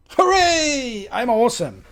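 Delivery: high, loud triumphant hooray; excited cheer. Real, not acted. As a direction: cheer like you just won. Code works, cheering.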